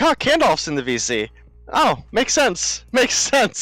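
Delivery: mock realization. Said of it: "I was like".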